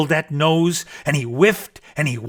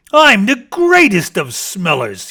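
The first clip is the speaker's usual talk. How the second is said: bragging; 6 semitones higher, 7 dB louder than usual. Acted. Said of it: "he bragged".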